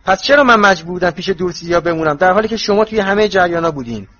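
high, loud resentful protest; rising indignation. A narrator's reading. Harry protesting being left out by friends, loudly indignant. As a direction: let the grievance pour out loudly. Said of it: "صدایش بلند و بلندتر می شد".

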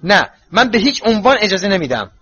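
high, loud, firm refusal; resisting. A narrator's reading. Mother resists her children's pleas, loudly adamant. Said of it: "مقاومت کرد".

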